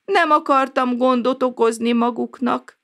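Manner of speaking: put-on an injured old neighbour apologizing from bed; sorry, ashamed old man voice; apologetic old man. A narrator's reading. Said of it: "mentegetőzött".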